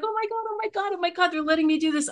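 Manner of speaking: thrilled babbling at the news; excited gushing. Retold or imitated. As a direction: bubble over with joy. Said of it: "So I was all excited".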